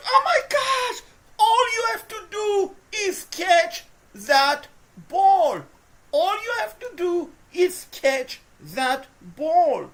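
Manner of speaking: high, loud, slow half-laughing wail; exasperated cry at the receiver; anguished frustrated yell. Real, not acted. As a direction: plead at the screen over a drop. Receiver drops the pass.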